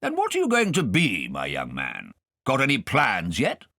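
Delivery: put-on high, loud blustering old gentleman; pompous guardian. Guardian quizzing Kay at dinner, pompous and loud. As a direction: quiz the boy grandly.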